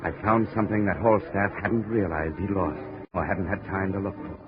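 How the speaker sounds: tense noir voice-over, building with the action; hard-boiled narration. A narrator's reading. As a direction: tell it like a weary detective looking back. Hard-boiled first-person narration over music.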